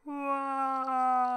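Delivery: high, loud shriek of Wawa; hammed-up childlike scream of Wawa; mock scream. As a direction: scream Wawa at the top of your lungs. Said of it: "she fucking screams at the top of her lu".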